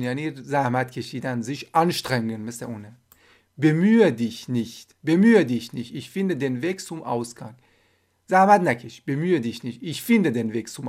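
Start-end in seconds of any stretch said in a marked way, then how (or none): 3.6-4.9 slow, syllable by syllable, each sound clear; slow model read. Shown as a demonstration. Teacher modelling Bemühe dich nicht for Persian learners, slow. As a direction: spell it out slowly for the learners. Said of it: "teacher models phrase twice".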